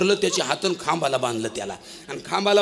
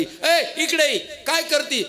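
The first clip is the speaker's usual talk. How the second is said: calling out; 9 semitones higher, much slower than usual. Acted. Husband calls wife under tree.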